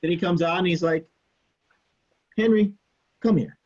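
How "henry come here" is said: raised, higher voice imitating a TA's summons; calling someone over. TA summons upset student from outside the lab, calling out. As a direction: call him over, mildly insistent.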